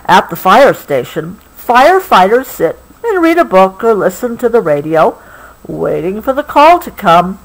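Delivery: storybook reading building to excitement; story reading. A narrator's reading. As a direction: build up to the bell for the child. Reading book text.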